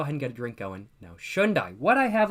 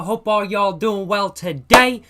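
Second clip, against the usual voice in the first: hype intro; 3 semitones higher, 8 dB louder than usual. Real, not acted. Stream opening greeting.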